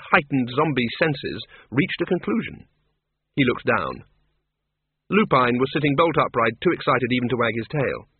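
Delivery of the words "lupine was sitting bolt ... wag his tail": plain reading voice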